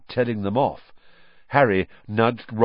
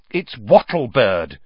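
impatient; 6 dB louder, slower than usual. A narrator's reading. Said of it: "said Hermione impatiently".

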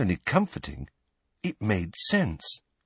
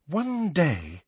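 high, exasperated opening; 9 semitones higher than usual. A narrator's reading. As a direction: begin with strained patience, exasperated. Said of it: "sounding thoroughly exasperated".

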